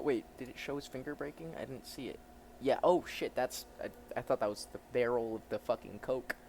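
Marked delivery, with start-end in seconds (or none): none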